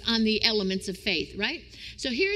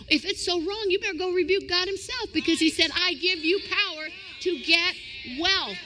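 preaching at full force; 7 semitones higher than usual. Acted. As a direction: build to a fervent peak. Preacher climaxes on wealth.

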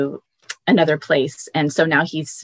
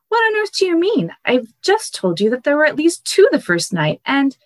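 put-on much higher, alarmed boy's voice; boy Shasta; 11 semitones higher than usual. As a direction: protest high and fast like a scared boy. Frightened boy Shasta argues with voice.

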